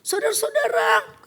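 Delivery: high, carried call to the passengers; street preacher voice. Retold or imitated. Evangelist calling out to train passengers, high preaching voice. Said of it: "Dia nginjil".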